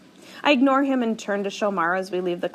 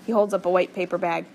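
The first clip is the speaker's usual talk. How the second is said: ordinary delivery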